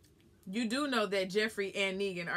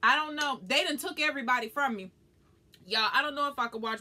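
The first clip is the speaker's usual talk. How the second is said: higher and louder, fed-up cry; exasperated lament; 6 semitones higher, 6 dB louder than usual. Real, not acted. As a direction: vent like they stole your favorites. Upset about losing characters.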